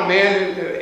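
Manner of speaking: ordinary delivery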